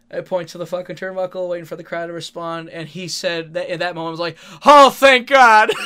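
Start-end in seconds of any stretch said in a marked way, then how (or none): 4.6-5.7 relieved shout. Retold or imitated. Relieved opponent catching his breath.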